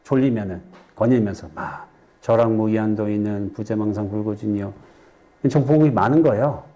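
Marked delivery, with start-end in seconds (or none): 2.2-4.7 low, monotone sutra-like recital; murmured chant from memory; memorised verse recitation. Shown as a demonstration. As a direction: recite by rote on one level note. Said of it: "증도가를 외웠어요".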